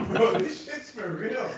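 slow, laughing through words. Real, not acted. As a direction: chuckle while saying it. Laughing at mic mixup.

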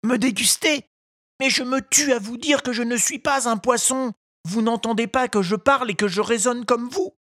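high, pleading puppet voice; a wooden boy arguing for his life; protesting puppet. A narrator's reading. Pinocchio protesting. (voix de pantin qui proteste)

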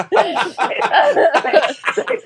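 punchline squeezed out through high laughter; laughing. Real, not acted.

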